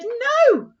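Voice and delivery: high, loud squealed no; a little pig shouting no; pig's shout. Acted. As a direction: yell the refusal up high.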